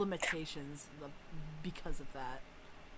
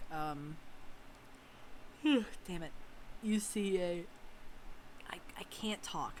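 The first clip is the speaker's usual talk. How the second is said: usual speaking voice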